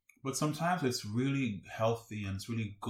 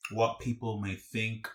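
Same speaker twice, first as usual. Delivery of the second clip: ordinary delivery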